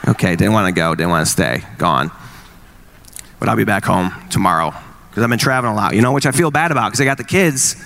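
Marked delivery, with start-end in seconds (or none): 0.1-4.7 sarcastic. Retold or imitated. Mocking wife's excuses.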